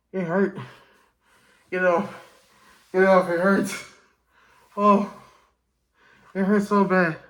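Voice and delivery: yelling in real pain to get it off. Real, not acted. Mask hurting, yelling to remove it.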